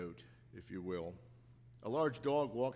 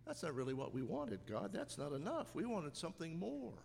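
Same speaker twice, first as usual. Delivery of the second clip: grumbling complaint; 6 semitones higher than usual. Retold or imitated. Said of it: "do we sometimes say".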